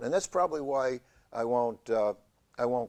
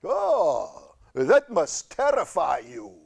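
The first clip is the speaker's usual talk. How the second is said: Lugosi-style cancer; 3 semitones higher, 6 dB louder, much slower than usual. Acted. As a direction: tease like a horror villain. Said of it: "reminds me of Bela Lugosi".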